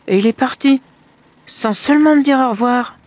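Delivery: high, loud complaint; father hurt his son left without goodbye, high and loud. A narrator's reading. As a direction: sound wounded.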